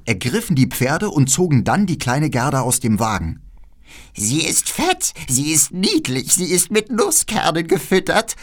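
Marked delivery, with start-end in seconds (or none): 4.1-8.4 hohe, langsame Hexenstimme; genüsslich wie eine hungrige Hexe; altes Räuberweib. Acted. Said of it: "sagte das alte Räuberweib". (Räuberweib begutachtet Gerda: langsame, hohe Hexenstimme)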